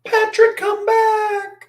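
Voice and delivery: high, loud, falsetto cry after Patrick; mock falsetto cry. Streamer hams up a high calling voice. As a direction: wail it way up high, pleading him back.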